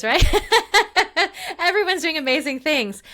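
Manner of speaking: talking through a big high laugh; bubbling over with laughter. Real, not acted. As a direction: laugh it out, delighted. Laughing at her awesome friends.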